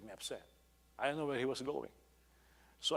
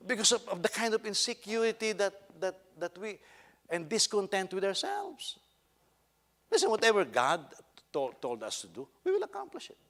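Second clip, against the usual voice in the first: raised, emphatic sermon delivery; forceful preaching; 9 semitones higher, 10 dB louder than usual. Real, not acted. Preacher urges believers to be content with their call, forceful and raised. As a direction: exhort the congregation with a high, driving voice.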